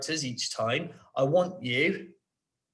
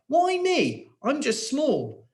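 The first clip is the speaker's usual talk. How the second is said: pleading question; 10 semitones higher, slower than usual. Shown as a demonstration. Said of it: "pigs asked a question".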